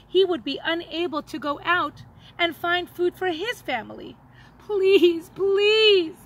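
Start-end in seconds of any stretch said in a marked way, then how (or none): 4.6-5.3 louder, desperate please; a stranger pleading for shoes. A narrator's reading. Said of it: "the man pleaded". 5.3-6.2 louder, desperate please; a stranger pleading for shoes. A narrator's reading. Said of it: "the man pleaded".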